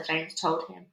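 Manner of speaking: usual speaking voice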